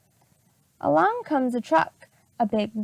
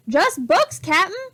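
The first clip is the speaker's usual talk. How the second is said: pirate shouts back, higher and louder; shouting; 6 semitones higher, 5 dB louder than usual. A narrator's reading. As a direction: call down from the treehouse. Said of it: "shouted Stinky".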